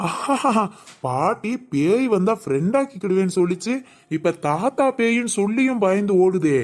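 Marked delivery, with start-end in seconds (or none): 0.1-0.6 high burst of laughter; giggle. Real, not acted. Boy laughing after scaring grandma. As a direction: laugh at granny's fright.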